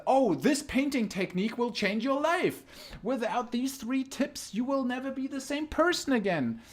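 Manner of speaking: high, hyped clickbait pitch. Pretend. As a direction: sell it like a cheesy ad. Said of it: "the title of the stream is so funny".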